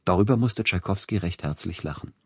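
plain reading voice

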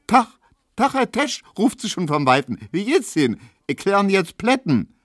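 high, cheery call from afar; chirpy young woman. Retold or imitated. Hedwig greeting Tesch from afar, high and chirpy. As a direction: greet him brightly across the square.

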